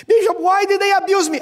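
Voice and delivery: fast, high, loud cry; anguished plea. Retold or imitated. Abused woman begging the bishop for why, loud and high. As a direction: hurl the question out in pain.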